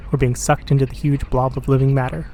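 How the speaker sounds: ordinary delivery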